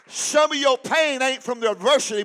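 loud, shouted repeat of the line; shouted repeat for emphasis. Shown as a demonstration. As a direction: hammer the line home at full voice. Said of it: "I said, some of you".

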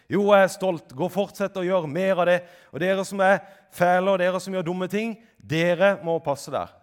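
high, forceful sarcastic pronouncement; mock approving Jesus. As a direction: parody a proud authority handing out verdicts. Parodied Jesus praising and scolding.

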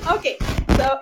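normal talking voice

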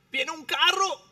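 high, loud alarm call; urgent warning call. Acted. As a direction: call out the danger fast. Warning of an oncoming car.

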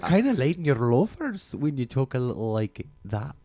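teasing in a mock Scottish lilt; slightly lilting Scottish voice; put-on Scottish accent. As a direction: tease them in a Scottish accent. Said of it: "Scottish accent it was".

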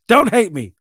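half-joking loud plea to the audience; blurted loud after laughing. Real, not acted. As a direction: burst out "don't hate me" loud after a laugh.

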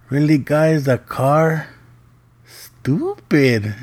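comic dismay, stretching the last word; mock exasperation. Dad to both kids over their mistake, exasperated.

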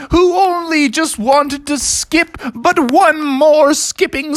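very high, slow melodramatic wail; moaning raccoon. Acted. Bitten raccoon lamenting his end, wailing. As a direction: moan out a hammed-up deathbed lament. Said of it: "a moaning raccoon going".

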